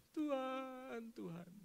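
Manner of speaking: soft, tearful call to God; mock weeping. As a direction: imitate a worshipper whimpering to the Lord. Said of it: "Oh, nangis". (pura-pura menangis memanggil Tuhan)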